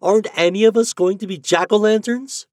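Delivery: high, loud worried questions; dismayed cry. A narrator's reading. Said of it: "he cried".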